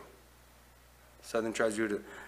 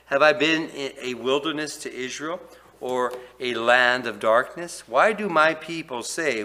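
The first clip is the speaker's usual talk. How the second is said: preaching scripture; 3 semitones higher, 10 dB louder than usual. Acted. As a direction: thunder the verses at the people. Reading scripture with force.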